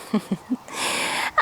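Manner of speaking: quiet, breath-only giggle; real low amused laugh; soft chuckle. Real, not acted. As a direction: chuckle softly at your own silliness. Laughing at own bird impression.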